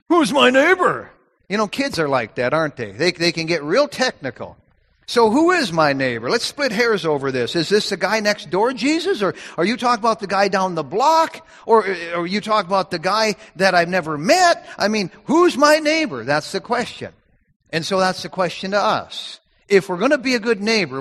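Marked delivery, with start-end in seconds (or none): 0.1-1.1 higher, louder nitpicking whine; preacher parodies the lawyer's question, mocking. 5.1-16.2 hair-splitting lawyer. Retold or imitated. Preacher acts out the lawyer splitting hairs.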